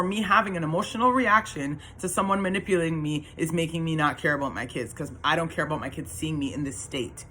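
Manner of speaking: ordinary talk, nothing marked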